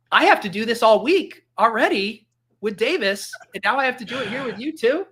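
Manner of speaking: high-pitched mock exasperation; exasperated protest. Acted exasperated reply to father-in-law.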